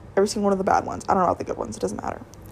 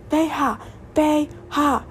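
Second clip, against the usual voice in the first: veja called out high and slow, each syllable stretched; mock yell of veja; 7 semitones higher, much slower than usual. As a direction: imitate her shouting veja down the phone, drawn out. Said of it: "is just yelling the word veja at me".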